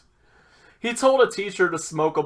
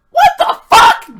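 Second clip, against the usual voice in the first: very high, loud, drawn-out outburst; shocked yell; 18 dB louder than usual. Retold or imitated. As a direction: screech it in disbelief, hammed-up retelling. Retold shocked reaction to compliment.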